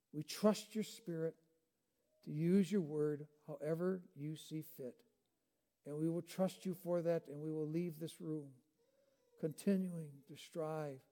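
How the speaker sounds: soft, low, reverent prayer; hushed closing prayer after the sermon. Real, not acted. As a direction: pray quietly and humbly.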